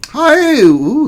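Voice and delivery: very high, loud hello; delighted greeting. Pretend. Streamer spotting a lovely viewer in chat, delighted high greeting. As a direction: squeal a playful hello.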